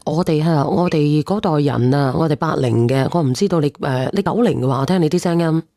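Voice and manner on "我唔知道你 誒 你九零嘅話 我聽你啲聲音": ordinary talk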